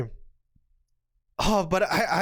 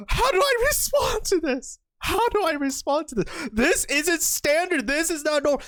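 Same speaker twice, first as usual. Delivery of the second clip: high, flustered imitation of a baffled player; hammed-up high-pitched dismay; mock panic; 6 semitones higher than usual. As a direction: act out helpless bewilderment. Voices the flustered opponent.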